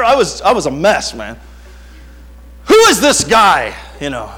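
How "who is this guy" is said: loud, high indignant question; hammed-up fatherly outrage; mock outraged demand. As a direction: act the suspicious, jealous father. Jealous father on daughter's suitor.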